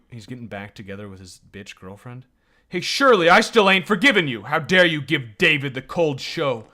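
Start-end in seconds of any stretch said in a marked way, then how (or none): 2.7-6.6 high, loud scolding yell at Shirley; angry shout. Acted. Voicing the character, yelling at Shirley for being cold to David. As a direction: tear into her for hurting David.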